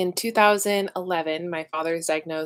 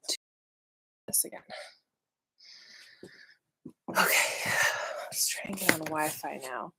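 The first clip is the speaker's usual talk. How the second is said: whisper; 2 semitones lower, 8 dB quieter than usual. Real, not acted. Muttering to herself while fixing stream.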